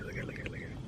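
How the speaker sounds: whispering at the tiny visitor; hushed. Real, not acted. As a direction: whisper so the animal isn't scared off. Sees a baby animal.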